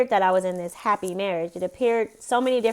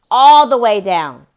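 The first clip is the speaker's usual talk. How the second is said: loud firm order; 14 dB louder than usual. Real, not acted. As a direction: insist firmly from another room. Telling child to lower volume.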